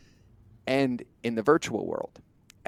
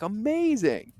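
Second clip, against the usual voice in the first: a single word sung out very high; mock delight; 11 semitones higher, slower than usual. Host playing the eager buyer saying yes, high mock delight. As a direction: gush like an easily sold customer.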